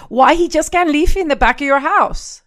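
put-on high, loud Spanish-accented pleading; hammed-up Spanish mom, baffled protest; mother's Spanish accent. Mother questioning daughter's separation, Spanish accent. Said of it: "her Spanish accent".

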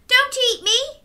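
put-on high, pleading child's voice; pleading little girl. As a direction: beg the wolf in a little girl's voice. Chloe pleads with wolf.

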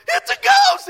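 very high-pitched comic shriek; terrified outcry. Retold or imitated. Preacher voicing disciples seeing Jesus appear, shrieking in mock terror.

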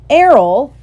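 put-on boy dismayed at his clumsy owl; exasperated cry. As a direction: groan out the owl's name in dismay. Owl crashes into milk jug.

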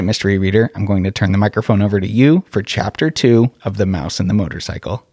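ordinary delivery, nothing marked

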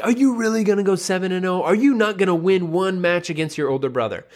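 high, incredulous goading; challenging dad. Retold or imitated. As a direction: voice a dad needling his son to fight back. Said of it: "went to motivate the younger son".

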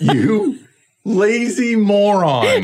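high, very slow drawn-out insult; mocking couch critic. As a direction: stretch out the insult in mock scorn. Jeering Olympians from couch.

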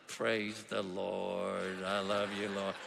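low, dragging monotone worship; mad, joyless praise. Acted. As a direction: sing praise begrudgingly, very slow and low. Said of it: "I mean, I'm so mad".

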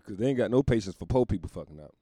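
ordinary talk, nothing marked

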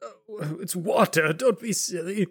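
high, put-on vendor voice; high-pitched, dismissive merchant; chiding merchant. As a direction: lightly scold in a high character voice. Game master voices Digsby.

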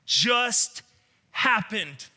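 high, loud, word-by-word disbelief; stunned wondering. Acted. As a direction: react incredulously to an impossible rescue. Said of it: "So relieved ... but wondering".

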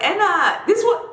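high, bright mock friend greeting; imitating friend's voice note. Friend leaving a late-night voice note, imitated high. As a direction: play the excited friend calling out. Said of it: "she's like".

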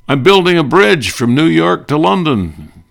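high, loud, bug-eyed Colonna impression; [name] voice. Colonna's reply in gag.

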